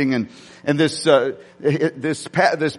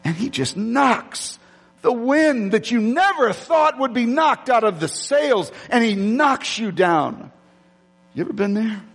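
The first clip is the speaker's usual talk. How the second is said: preaching build; 7 semitones higher, slower than usual. Acted. Preaching enemy attack.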